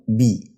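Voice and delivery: "بي" drawn out slowly as bi; slow, clear i vowel in "بي"; kasra on the ba. Shown as a demonstration. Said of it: "ده الكسر بتاع الحرف".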